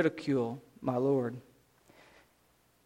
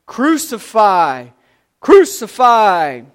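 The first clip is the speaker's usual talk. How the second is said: loud, drawn-out shout, pitched high; 4 semitones higher, 12 dB louder, much slower than usual. A narrator's reading. Priests and temple guards demanding an execution, shouting. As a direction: shout it slowly like a mob. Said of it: "began shouting".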